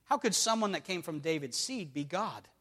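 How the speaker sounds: low, soft reading of scripture. Acted. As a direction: read the verses quietly and steadily. Preacher reading scripture aloud.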